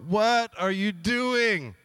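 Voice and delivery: slow, high drawn-out exasperation; flipping out. Retold or imitated. King exasperated at Balaam's third blessing, slow high disbelief. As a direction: drag it out in disbelief. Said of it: "The king i-, is flipping out".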